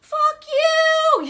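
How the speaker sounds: high, loud mock outburst at someone; furious shout. Talking about getting mad when triggered, a high furious yell. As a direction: yell it like you've lost your temper. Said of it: "getting mad and frustrated and be like".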